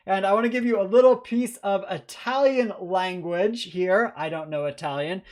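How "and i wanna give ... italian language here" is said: normal talking voice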